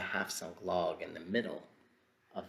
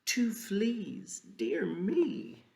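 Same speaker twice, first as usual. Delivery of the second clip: high squeaky voice; 11 semitones higher, 3 dB louder, much slower than usual. Acted. Bugs escape the frog.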